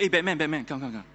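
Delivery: acted eager shout to a friend; enthusiastic. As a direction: call him over eagerly, then ease off. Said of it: "Very enthusiastic".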